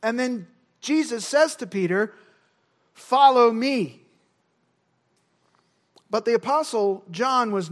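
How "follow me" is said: slow, loud, weighty "Follow me"; firm command. Acted. Jesus calling Peter to follow, firmly commanding. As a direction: give a deliberate, louder order.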